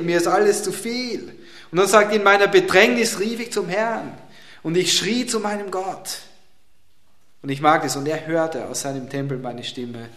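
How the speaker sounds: ordinary delivery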